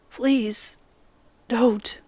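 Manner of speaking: usual speaking voice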